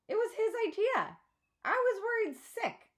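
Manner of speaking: a fretting mother explaining; mother's worried voice. A narrator's reading. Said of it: "she sighs".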